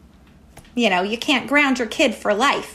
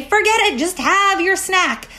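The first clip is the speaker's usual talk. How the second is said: giving in; 8 semitones higher than usual. Retold or imitated. Parent caving to a whining kid, exasperated. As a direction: throw up your hands and cave. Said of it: "until finally you say".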